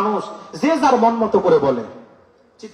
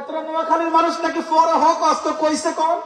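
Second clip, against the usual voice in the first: higher teasing quote, lightly voiced; teasing Noakhali reply; 6 semitones higher than usual. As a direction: toss the mockery back, pitched up. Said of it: "কয়".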